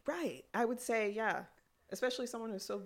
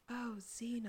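breathy voice; 5 semitones higher, slower than usual. Retold or imitated. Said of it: "Oh, all breathy".

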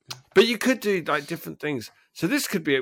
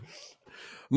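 ordinary talk

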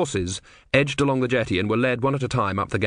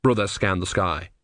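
ordinary delivery, nothing marked

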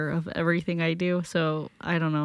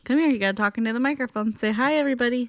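higher, coaxing parent voice; sing-song parent prompting; 6 semitones higher, faster than usual. Real, not acted. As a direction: gently prompt a little one to speak up. Parent coaxing child to microphone.

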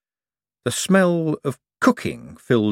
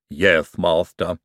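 a slow, low lightly voiced servant; slow, lowish Igor voice; 4 semitones lower, slower than usual. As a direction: drag it out like a humble henchman. Igor answers Hubert.

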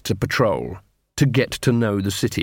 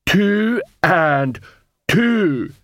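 laboured, raised-pitch troll counting; slow-witted troll; 8 semitones higher, much slower than usual. Acted. Troll counts the dwarf's fingers, laboured and dull-witted.

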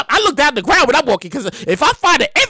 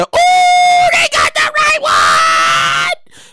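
very high, loud drawn-out whoop; high sustained hollering; hollered catchphrase; 4 dB louder, much slower than usual. Acted. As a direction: belt out the segment catchphrase. Catchphrase hollered for segment title.

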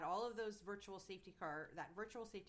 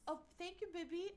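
Leclerc; 9 semitones higher than usual. Retold or imitated. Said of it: "Leclerc is like".

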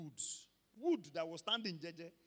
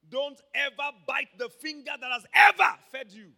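loud, high warning shout to the church; 4 semitones higher, 18 dB louder than usual. Real, not acted. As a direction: thunder the warning at them. Preacher warns the congregation.

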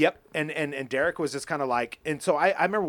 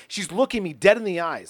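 ordinary delivery

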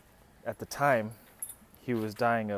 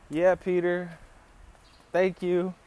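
higher-pitched, halting politeness; mock polite reply; 6 semitones higher, slower than usual. Boyfriend answers her dad on the couch, mock polite reply. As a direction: imitate a caught boyfriend answering politely, with pauses.

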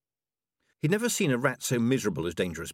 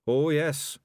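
Dangerous Beans; 8 semitones higher, 5 dB louder than usual. A narrator's reading. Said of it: "said Dangerous Beans".

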